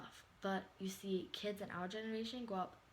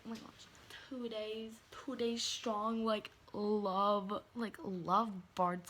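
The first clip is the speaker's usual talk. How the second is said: loud, drawn-out mock gushing over two days; mocking lovestruck teen; 7 dB louder, slower than usual. Mocking classmates' posts, loud slow gushing. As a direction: ham up a smitten kid bragging. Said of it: "And they're like".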